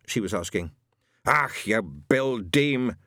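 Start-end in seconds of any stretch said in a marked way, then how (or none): 1.2-2.9 muttered Scots grumble. A narrator's reading. Shadwell overhearing a man, slow Scottish mutter. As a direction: grumble it slowly under his breath. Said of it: "muttered Shadwell".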